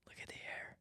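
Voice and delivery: breathless whisper. Real, not acted. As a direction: whisper it under your breath. Repeats it whispered.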